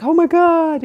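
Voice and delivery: high, loud retold wail; reliving panicked dismay; frantic alarm. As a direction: cry out in mock alarm. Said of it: "so in that space".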